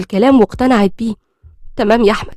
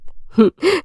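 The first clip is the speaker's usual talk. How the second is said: short, soft, high chuckle; soft acted chuckle; amused laugh; 5 semitones higher, 5 dB quieter than usual. A narrator's reading. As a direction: give a brief little laugh in character. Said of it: "منى ضحكت".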